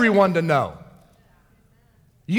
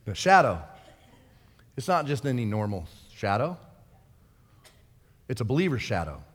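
very low and soft, with weight; quiet, low preacher's emphasis; hushed awe; 11 semitones lower, 9 dB quieter than usual. Real, not acted. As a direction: drop almost to a murmur for reverence. Preacher drops to awed hush for emphasis.

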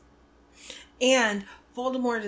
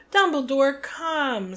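angry; 5 semitones higher, 7 dB louder, slower than usual. A narrator's reading. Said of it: "said Fudge angrily".

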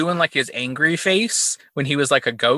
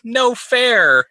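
put-on high-pitched whiny toddler protest; put-on little-kid voice, pitched up; 9 semitones higher, 4 dB louder than usual. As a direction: imitate a small child's last complaint. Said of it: "the little kid is like".

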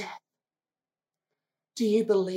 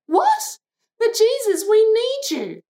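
alarmed plea; 11 semitones higher, 5 dB louder than usual. Acted. Sisters pleading when Jesus delays.

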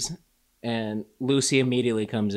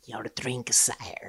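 soft, breathy-voiced fawning servant; a hushed mock servant offering the drink; 8 dB quieter, much slower than usual. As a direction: hush it out like a creepy butler. Mocking the witch's servant.

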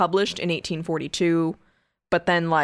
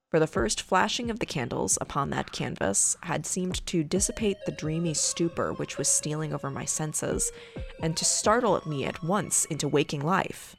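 hushed creepy legend telling; 2 semitones lower, 3 dB quieter than usual. Acted. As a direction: read it like a ghost story by candlelight. Reads Poe tale over music.

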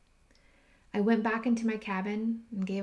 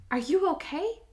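high and louder, asking in shock; startled disbelief; 8 semitones higher, 5 dB louder than usual. Retold or imitated. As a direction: jump up in pitch, stunned by her own tears. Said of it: "And my mind's going".

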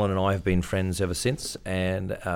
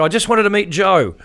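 calling out; 11 semitones higher, 8 dB louder than usual. Retold or imitated. Calling through door.

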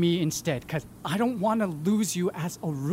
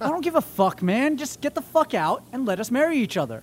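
higher, louder furious telling-off; angry yelling; 6 semitones higher, 6 dB louder than usual. Acted. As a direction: throw the ex out, shouting. Tells Chet to get out.

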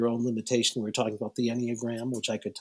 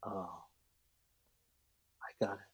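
soft realization; 2 semitones lower, 11 dB quieter than usual. Retold or imitated. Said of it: "And then she says".

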